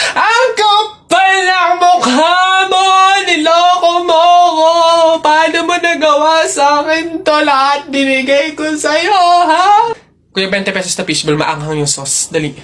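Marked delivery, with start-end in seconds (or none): 0.1-9.9 tearful shouted confrontation. Acted. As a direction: shout the betrayal like a soap opera. Acted lovers' quarrel for video.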